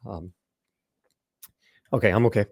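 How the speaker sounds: ordinary delivery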